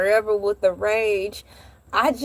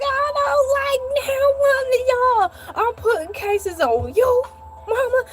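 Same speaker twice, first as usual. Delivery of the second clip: put-on much higher, tearful child threats; crying angry child; 9 semitones higher than usual. Crying child threatening mom and dad after the scare. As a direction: sob out threats like a wronged little kid. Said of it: "I'm like".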